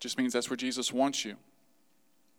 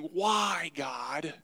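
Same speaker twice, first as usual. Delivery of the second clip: drawn-out, raised, plaintive "Why"; questioning God. Acted. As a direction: cry out a complaint to God. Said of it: "instead of asking".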